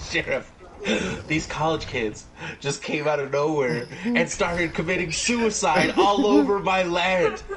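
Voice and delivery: lightly voiced hillbilly, laughing at the end. A hint of Tucker explaining to the sheriff, laughing. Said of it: "he's like".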